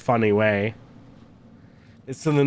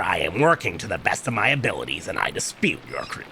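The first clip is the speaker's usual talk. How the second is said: forgotten character voice; 8 semitones lower, 3 dB quieter than usual. Acted. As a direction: read it low and measured, trying out a character. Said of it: "I totally forget the voice I did for this guy".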